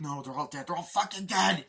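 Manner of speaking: climbs to a high, loud scream; frantic yelling woman; hysterical shout. Retold or imitated. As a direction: shriek the horror out. Bloodied woman in shock.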